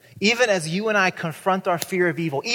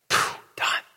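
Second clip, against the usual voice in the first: breathy mock relief. Jesus voiced relief.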